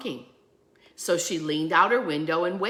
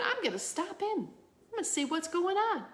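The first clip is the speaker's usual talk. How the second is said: lightly voiced woman, higher and softer; her inner voice; 11 semitones higher, 6 dB quieter than usual. Woman deciding to stop at the store, higher and softer. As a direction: think it aloud as the eager shopper.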